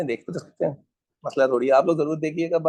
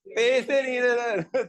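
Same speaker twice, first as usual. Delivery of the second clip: high, slow mock-plaintive answer; acted broke student; 6 semitones higher, slower than usual. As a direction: drag out a hard-up student's excuse. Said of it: "ये बोलना है आप लोगों ने".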